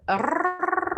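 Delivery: "crrr" with a long trilled rolled r, high. Shown as a demonstration. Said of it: "You can't roll your tongue?".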